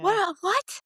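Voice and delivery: high, startled squeak of disbelief; stunned well what. Retold or imitated. Mom retelling her reaction to the news, high and startled. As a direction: pitch it way up like you can't believe it. Telling son's dad in private.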